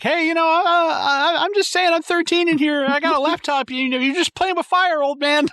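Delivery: put-on high, loud cocky kid; bratty teen voice. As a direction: sneer at the old man as a cocky teen. Voicing the teen Rick-style.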